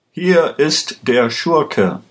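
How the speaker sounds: high, slow accusing call; drawn-out cry without full volume. A narrator's reading. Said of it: "schrie Vega dem Richter zu".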